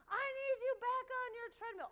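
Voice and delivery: high, loud order; mock trainer yell. Trainer ordering runners back on treadmill, loud. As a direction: call it out like a drill coach. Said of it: "she's like".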